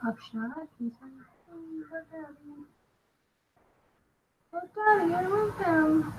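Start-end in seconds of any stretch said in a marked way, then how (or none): 1.5-6.1 small, high-pitched pleading cub voice; sad lion cub. Acted. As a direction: plead up to daddy as a little cub. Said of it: "how sad the lion cub is".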